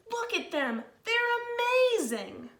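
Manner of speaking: high, slow, starry-eyed slug voice; awed little slug. Acted. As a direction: stretch out amazing in wonder. Norman admires the snails.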